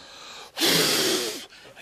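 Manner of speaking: flame whoosh. Retold or imitated. Fire-eater blowing flame.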